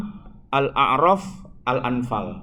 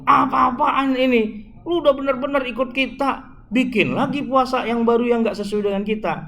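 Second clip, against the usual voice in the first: furious outburst; 8 semitones higher than usual. Acted. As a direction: explode in disbelief, pitched high.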